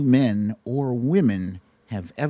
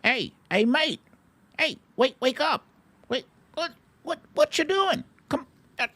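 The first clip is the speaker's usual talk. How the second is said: high, pushy voiced kangaroo; voicing a kangaroo; faster than usual. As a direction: nag the sleeper awake in a silly high voice. Voicing the kangaroo waking someone.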